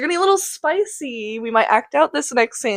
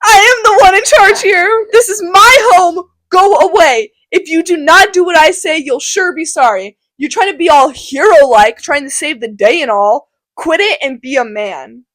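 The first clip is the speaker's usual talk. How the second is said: much louder, higher scolding uncle; angry Capulet; 5 semitones higher, 7 dB louder than usual. Acted. Capulet scolding Tybalt loudly in his home. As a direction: lay down the law in your house.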